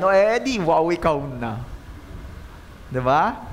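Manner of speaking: slow, mock admiring wow; sarcastic wow. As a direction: roll your eyes at the bragger. Sarcastic reaction to boast.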